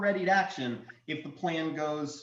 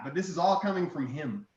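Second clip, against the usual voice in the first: usual speaking voice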